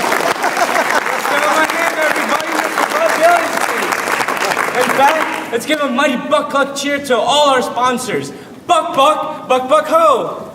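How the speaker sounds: loud, high crowd chant; rallying cheer. Acted. Host leading the crowd in a loud cheer.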